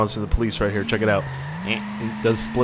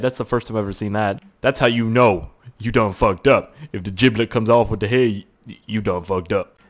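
usual speaking voice

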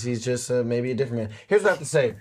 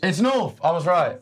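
higher, crowing triumph; triumphant; 6 semitones higher, 4 dB louder than usual. Real, not acted. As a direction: gloat at being proved right. Crowing after winning the geography debate.

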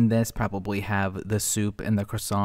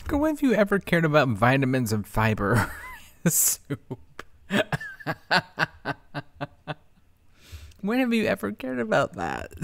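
laughing through the words; incredulous laugh while talking; laughing disbelief; 3 semitones higher than usual. Real, not acted.

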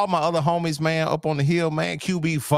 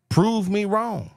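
playful challenge; 8 semitones higher, slower than usual. Real, not acted. Challenging co-host after his take.

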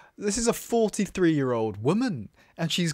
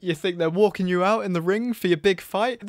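ordinary delivery

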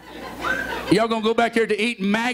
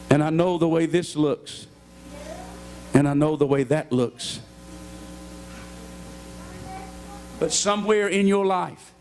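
hushed preaching; 5 semitones lower, 3 dB quieter than usual. Acted. Pastor speaking softly to the struggling listener, hushed and low. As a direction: bring the church down low and close.

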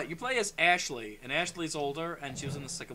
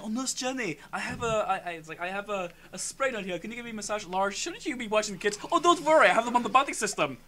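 lightly acted, higher-pitched counselor; imitated camp counselor; 5 semitones higher, 6 dB louder than usual. Counselor asking the nurse for a massage, lightly imitated. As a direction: play the counselor sweet-talking, rising as it goes. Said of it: "He's, like, saying".

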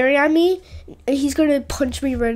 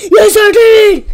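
high, loud cry of victory; real excited shout; 8 semitones higher, 13 dB louder, slower than usual. Real, not acted. Gamer yelling after pulling off the win, excited shout. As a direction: scream out the win. Winning the round, celebrating.

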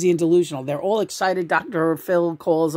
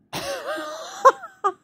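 squealing laugh. Real, not acted. Cracking up at own joke.

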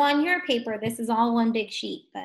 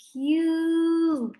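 CUBE stretched on a higher held note; drawn-out slow; 6 semitones higher, 3 dB louder than usual. Shown as a demonstration. Teacher asking for the middle sound, drawn-out slow CUBE. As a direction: hold the long U in CUBE. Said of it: "What do you hear in the middle".

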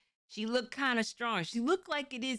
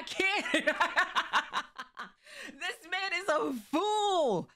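laughing; 10 semitones higher, much slower than usual. Real, not acted. Reacting while laughing hard.

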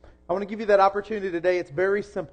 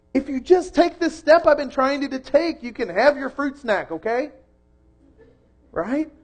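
normal talking voice

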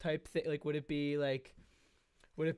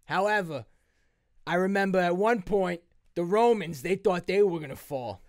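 loud, higher Italian mobster speech; rally the team like a mob boss; Italian mobster hero; 4 semitones higher, 7 dB louder than usual. Acted. To the Avengers, a mobster hero giving a loud pep talk. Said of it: "always brings it back to Italy".

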